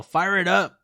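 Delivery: high, loud mock battle cry; imitated yell. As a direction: yell it like a rowdy gang. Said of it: "The guys yell".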